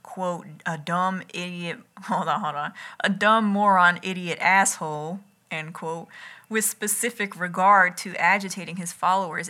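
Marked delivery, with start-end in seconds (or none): none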